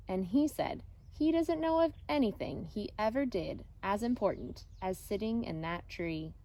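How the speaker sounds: usual speaking voice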